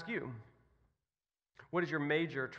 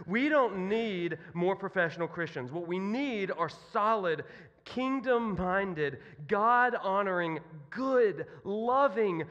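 louder, higher, each quality hit separately; emphatic preaching; 4 semitones higher, 4 dB louder than usual. Real, not acted. Preacher tells students what the world needs, emphatic preaching. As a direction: drive it home, drawing out the key words. Preacher restates his point with force.

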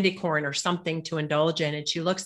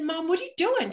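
much higher, startled question; surprised; 12 semitones higher than usual. Retold or imitated. Daughter finding snacks on her mother's belly, surprised. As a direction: catch her in the act.

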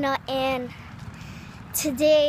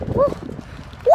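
high, very loud whoops; thrilled screams; 14 semitones higher, 6 dB louder than usual. Real, not acted. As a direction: scream with joy and fright. Racing downhill.

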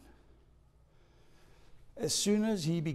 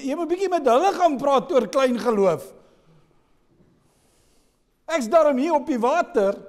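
higher, louder offended protest; indignant protest; 10 semitones higher, 7 dB louder than usual. Acted. Peter objecting to Jesus about his faith, offended. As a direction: act insulted, point at yourself.

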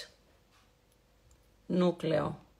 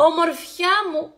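delighted gasp; 7 semitones higher, 3 dB louder than usual. Real, not acted. Excited greeting to a viewer.

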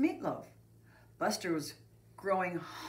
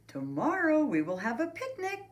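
higher, excited teacher's announcement; announcing; 10 semitones higher, 4 dB louder than usual. A narrator's reading. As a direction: announce a treat to the class. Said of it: "made an announcement".

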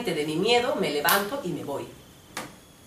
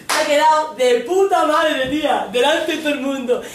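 loud, raised-pitch sarcastic rant; acted blow-up, mock approval; sarcastic shouting; 7 semitones higher, 7 dB louder than usual. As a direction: drag out the praise as a furious jab. Acted boyfriend ranting at her.

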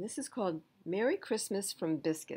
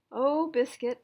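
put-on high, louder delighted little girl; girl delighted with her puppy; excited girl; 7 semitones higher, 8 dB louder, slower than usual. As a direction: gush over the puppy.

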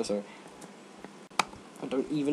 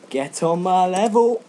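loud, slow, higher brag; boastful; 7 semitones higher, 8 dB louder, slower than usual. Pretend. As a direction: gloat like you just won. Boasting after loot.

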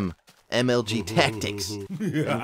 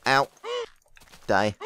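ordinary talk, nothing marked

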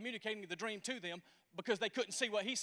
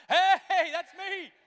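loud, high "Hey"; sudden loud call; old people shouting; 8 semitones higher, 12 dB louder, much slower than usual. Acted. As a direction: shout it out from the pews. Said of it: "old people should be shouting".

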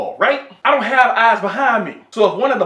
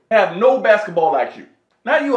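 usual speaking voice

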